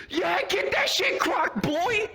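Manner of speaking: very high, drawn-out mock holler; squealed falsetto heckle, stretched out; yelling from background. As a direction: imitate hyped friends cheering from the back. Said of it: "We all start yelling from the background".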